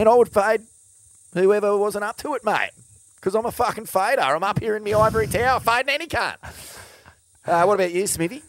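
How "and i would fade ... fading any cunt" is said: high, loud mock bravado; cocky boast. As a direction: brag like a top-dog fader. Boasting about being a fader.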